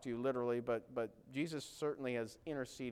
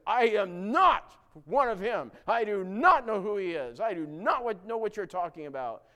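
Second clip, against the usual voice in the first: frantic denial; 5 semitones higher, 11 dB louder than usual. Retold or imitated. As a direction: push every word out loud to shake off the accusation. Peter denying Jesus to accusers.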